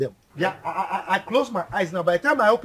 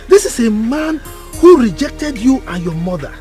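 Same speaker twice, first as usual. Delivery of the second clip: louder, building furious tirade; rising anger, louder and louder; angry rant; 3 semitones higher, 7 dB louder than usual. Acted. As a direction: hammer home the king's betrayal. Uncle ranting about the king.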